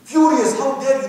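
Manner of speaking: how dare you, spat in outrage; furious. Retold or imitated. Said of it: "And Muslims were furious".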